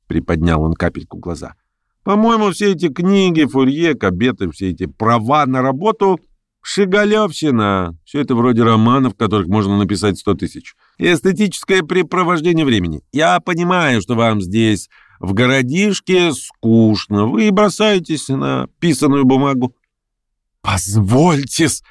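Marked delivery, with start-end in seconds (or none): 2.0-19.7 lightly voiced disdain, pitch often lifted; dismissive speaker. Verkhovensky dismisses the theories to bored provincials, dismissive. 20.6-21.8 slow, stretched-out objection; agitated. A narrator's reading. Lame man twitches and objects, agitated. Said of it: "задёргался на стуле Хромой".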